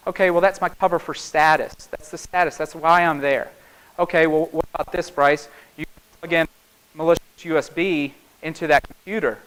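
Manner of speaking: ordinary talk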